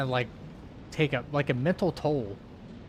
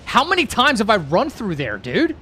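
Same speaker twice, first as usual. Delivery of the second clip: louder, pushed complaint; exasperated yell; 5 semitones higher, 6 dB louder than usual. Real, not acted. Streamer failing the same section again, loud exasperated yell. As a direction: vent the frustration at the screen. Raging at repeated runs.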